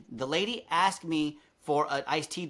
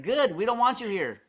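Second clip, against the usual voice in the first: higher, louder comeback; retold defiant retort; 6 semitones higher, 3 dB louder than usual. Server to departing rude guest, defiant. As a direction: replay a curt send-off, punching the first word.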